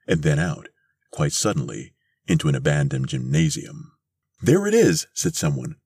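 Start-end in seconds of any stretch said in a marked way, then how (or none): 4.4-5.1 very high, loud exclamation; excited discovery. A narrator's reading. Someone in the crowd spots the rocket, excited. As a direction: call out the sighting at top pitch.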